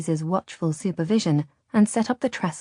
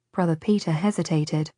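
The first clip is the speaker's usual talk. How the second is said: plain reading voice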